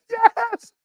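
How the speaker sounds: high-pitched mock squeal of joy; jubilant cheer. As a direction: celebrate a sudden release with a high cry. Celebrating release from jail.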